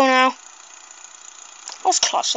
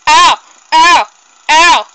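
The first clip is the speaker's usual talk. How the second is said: imitating lawnmower man; 4 semitones higher, 8 dB louder, slower than usual. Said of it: "Little more man just went".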